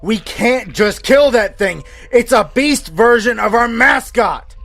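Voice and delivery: high, slow, emphatic shout. A narrator's reading. Staffer overruling colleagues about the beast, shouting loudly. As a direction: yell each word over the others. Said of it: "replied with a louder".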